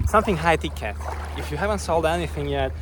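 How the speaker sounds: ordinary talk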